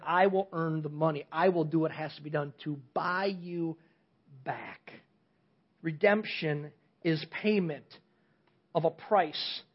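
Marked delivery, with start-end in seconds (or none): 0.1-4.9 softer and lower, a solemn vow; God quietly vows to buy humanity back, softer and lower; solemn tender promise. Acted. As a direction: promise it quietly with deep resolve. God voiced as redeemer.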